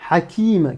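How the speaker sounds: "Hakim" begun with a rough throat breath; Arabic ḥ sound. Shown as a demonstration. Teacher saying the name "Hakim" with a scraped h. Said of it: "et le mot hakim".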